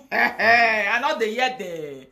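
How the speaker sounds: slow, laughing through the words. Real, not acted. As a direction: laugh as you say it knowingly. Laughs while repeating line.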